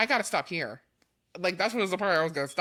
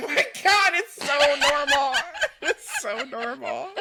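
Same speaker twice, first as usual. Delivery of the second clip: giggling through the words; laughing; 8 semitones higher, slower than usual. Real, not acted. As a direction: laugh while saying it. Laughing at plain name.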